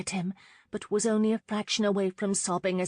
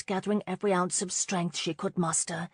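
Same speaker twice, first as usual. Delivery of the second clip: usual speaking voice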